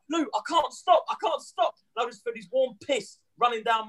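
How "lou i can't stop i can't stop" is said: high, pleading little brother voice, much higher; panicky little brother. Retold or imitated. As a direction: squeak it out like a kid who can't stop peeing. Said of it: "and he's like".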